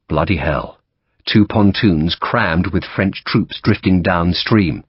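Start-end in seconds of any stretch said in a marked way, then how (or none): none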